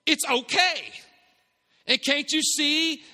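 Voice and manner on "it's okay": higher, punched call to a disciple; hammed-up exasperated order, half in fun; imitating a worked-up teacher correcting a follower; indignant Jesus. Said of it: "Can't you just see him looking at old Peter's face and say".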